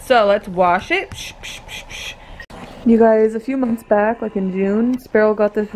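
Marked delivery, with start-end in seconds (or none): 1.1-2.1 water spray noise. Pretend. Washing toy horse's hair.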